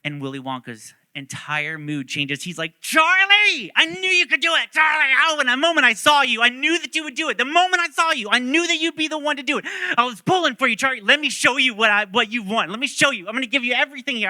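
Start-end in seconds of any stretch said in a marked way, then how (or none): 2.8-14.3 high, loud burst of overjoyed excitement; elated, gushing praise at full tilt; joyous. Retold or imitated. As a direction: shout it out, bursting with pride in the kid.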